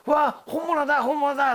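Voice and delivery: put-on high, excited kid voice; a child marvelling at a celebrity; thrilled kids. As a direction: gasp at the real thing.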